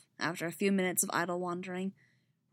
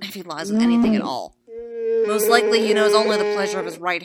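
furious Wookiee rant; 2 semitones higher, 7 dB louder than usual. Acted. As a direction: bellow it like a grieving Wookiee warrior. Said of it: "Chewie's version of what happened".

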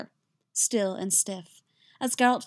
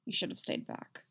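soft, gruff scolding; 8 dB quieter than usual. A narrator's reading. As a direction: grumble it under the breath. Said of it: "he says gruffly".

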